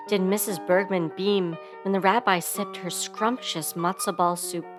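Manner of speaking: usual speaking voice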